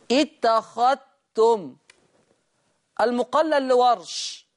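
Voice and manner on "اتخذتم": اتخذتم: الذال مدغمة في التاء، اتختم، ببطء; أدغم الذال في التاء; إدغام الذال في التاء. Shown as a demonstration. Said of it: "بيضغم الذال". (اتخذتم بالإدغام)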